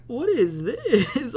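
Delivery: slow, high, laughing in disbelief. Retold or imitated. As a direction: drag it out through a laugh. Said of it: "I was like".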